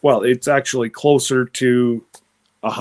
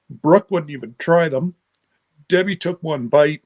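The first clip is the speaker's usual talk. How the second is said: normal talking voice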